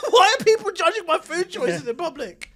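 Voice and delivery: high, loud, laughing complaint; exasperated, laughing through it; laughing outrage. Retold or imitated. Telling the friend about the food refusals, laughing outrage. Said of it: "And I'm like".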